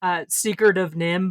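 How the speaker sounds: ordinary talk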